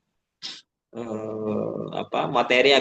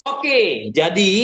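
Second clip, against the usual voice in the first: higher, projected attention-getter; raised intonation, volume; 3 semitones higher, 4 dB louder, much slower than usual. Shown as a demonstration. Trainer signalling participants to refocus, raised projected voice. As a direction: lift the voice to regain focus. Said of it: "Kita naikkan intonasi kita, kita tinggikan volume kita".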